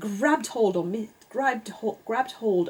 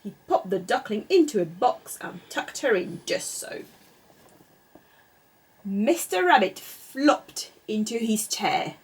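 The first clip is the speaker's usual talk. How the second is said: plain reading voice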